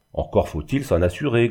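usual speaking voice

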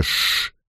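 whispered shushing sound; a quick quiet shh; soft hush. A narrator's reading. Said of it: "Лойл издал тихое".

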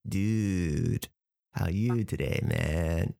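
drawn-out laid-back surfer greeting; Southern California surfer. Shown as a demonstration. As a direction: imitate a Californian surfer dude. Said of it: "Southern California surfers".

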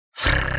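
low fluffy creature snort; a pet's sharp snort. A narrator's reading. Fluffy creature snorts at the quarrel. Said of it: "фыркнула белая пушистая прелесть".

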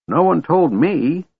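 put-on high, slow, indignant old man; indignant gasp. A narrator's reading. Old man learns good dragons have returned, indignant. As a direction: draw it out in high-pitched indignation. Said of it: "the old man gasped indignantly".

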